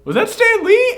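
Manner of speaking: sudden high-pitched double take, retold; surprised question. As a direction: squeak it out as if you just spotted him. Retold own surprised reaction.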